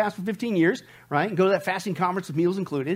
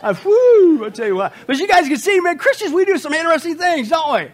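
fired-up preaching; 9 semitones higher, 7 dB louder than usual. Acted. Preaching with raised energy.

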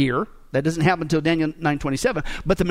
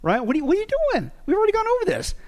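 high, mock exasperated rebuke; exasperated scolding; 7 semitones higher than usual. Paul scolding the confused Christians, hammed-up exasperation. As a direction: act out an impatient scold, pitched way up. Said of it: "to the Chrome vernac".

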